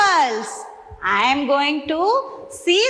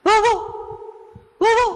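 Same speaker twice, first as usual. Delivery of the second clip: dog bark; 4 semitones higher than usual. Shown as a demonstration. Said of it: "I will make a sound of an animal".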